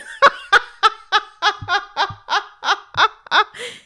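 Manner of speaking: long high shrieking laugh; laughing fit on the floor; rolling laughter. Acted. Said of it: "rolling on the floor with laughter".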